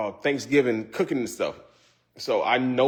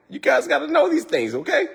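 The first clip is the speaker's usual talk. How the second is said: high emphatic; 13 semitones higher than usual. Acted.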